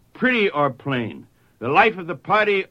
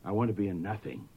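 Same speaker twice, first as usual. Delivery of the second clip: quiet rueful echo; 6 semitones lower, 10 dB quieter, faster than usual. Shown as a demonstration. Preacher echoing the boy's words, hushed and rueful. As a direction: repeat it softer, with quiet dismay. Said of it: "mind you, with that kind of ambition".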